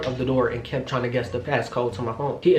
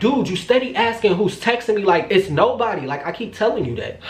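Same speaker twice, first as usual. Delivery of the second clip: higher-pitched, fed-up insisting; exasperated protest; 6 semitones higher than usual. Retold or imitated. Woman telling boyfriend nobody is texting her, exasperated. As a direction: protest as if repeating it for the umpteenth time. Retold argument over who texted.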